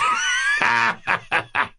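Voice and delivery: high, booming laugh; kingly guffaw. A narrator's reading. King laughing at his joke, hearty guffaw. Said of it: "his laugh was chorused by the seven".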